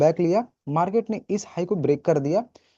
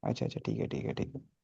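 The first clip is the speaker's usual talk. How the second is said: relieved mutter; 4 semitones lower, 14 dB quieter than usual. Real, not acted. Relieved after recording check.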